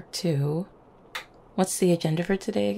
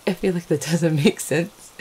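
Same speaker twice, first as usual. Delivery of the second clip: laughing through the words, louder; amused confusion; 5 dB louder than usual. Acted. Muddling a compliment to a partner, laughing. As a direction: crack up at your own muddled sentence.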